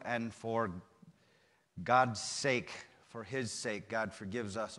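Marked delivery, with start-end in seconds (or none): none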